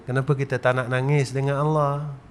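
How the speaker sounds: ordinary talk, nothing marked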